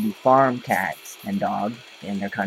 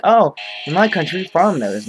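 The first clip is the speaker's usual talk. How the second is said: mimicking the girl; 7 semitones higher, 4 dB louder than usual. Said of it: "She's like".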